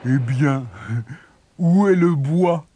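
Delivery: slow, taunting question; mocking. A narrator's reading. Elder brother taunting his returned brother, mocking. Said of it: "questionna son aîné d'un air goguenard".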